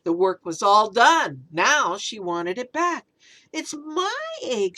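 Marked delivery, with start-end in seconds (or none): 3.5-4.8 sputtered. Acted. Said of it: "she sputtered".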